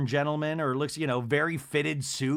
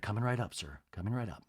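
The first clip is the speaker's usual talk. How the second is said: low quiet butler voice; 5 semitones lower, 9 dB quieter, faster than usual. Retold or imitated.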